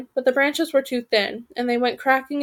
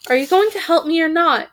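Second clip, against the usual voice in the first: cried out; 5 semitones higher, 4 dB louder than usual. Acted. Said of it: "she cried".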